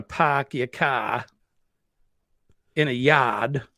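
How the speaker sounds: broad Boston accent with the Rs dropped. Shown as a demonstration. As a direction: show off a New England Boston accent, open "ah" for "ar". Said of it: "that's the accent".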